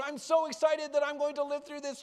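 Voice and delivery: high, loud, excited bird voice; excited little bird. Acted. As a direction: squeal it out like a bird that will live. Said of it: "he was so excited".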